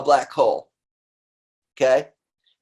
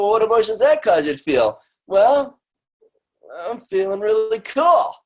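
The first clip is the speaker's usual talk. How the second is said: acted dialogue; 7 semitones higher than usual. Acted coach and client dialogue.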